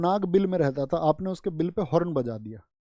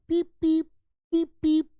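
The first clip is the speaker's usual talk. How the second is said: high squeaky horn beeps; horn beep imitation; 11 semitones higher, 4 dB louder than usual. Teacher imitating a scooter horn, high squeaky beeps.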